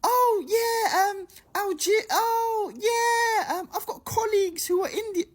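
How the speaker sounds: very high-pitched imitation of a woman; mock woman's voice. Storyteller imitating drunk woman answering "I'm Algerian", high mock voice. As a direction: put on a squeaky, clueless woman's voice. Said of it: "one woman goes".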